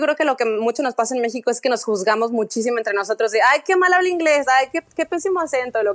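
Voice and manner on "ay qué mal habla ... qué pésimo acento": a higher, sneering imitation of a judgmental critic; mocking snob. Imitating Mexicans mocking each other's English, a higher sneering voice. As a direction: sneer like a gossip picking on someone's English.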